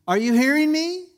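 high, loud "Are you hearing me?", climbing steadily to the end; imitating emphatic mom. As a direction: mom checking her kid listens, pitch rising through "Are you hearing me?". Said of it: "Mom's way of emphasizing the right syllable".

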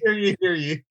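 lightly voiced town crier call. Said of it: "the town crier all of a sudden".

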